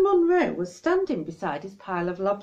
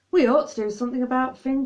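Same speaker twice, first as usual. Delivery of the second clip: urgent; 6 semitones higher, 5 dB louder, faster than usual. Acted. Jazz urgent.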